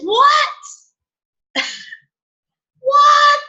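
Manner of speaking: high, loud mock-incredulous "what"; energy's shocked reaction. Energy stops her in her tracks, mock shock. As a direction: exclaim it sky-high in exaggerated disbelief. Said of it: "the energy was like".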